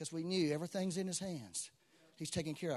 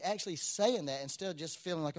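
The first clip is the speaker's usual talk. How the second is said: ordinary talk, nothing marked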